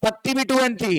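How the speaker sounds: ordinary delivery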